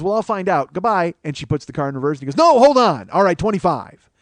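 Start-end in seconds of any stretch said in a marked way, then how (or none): 0.0-1.1 higher, louder dismissal; bluffing goodbye. Retold or imitated. Wife telling the kid she will ask others, raised voice. 2.4-4.1 high shout easing into loud concession; frantic giving in. Retold or imitated. Kid halting the reversing car and agreeing, loud and frantic.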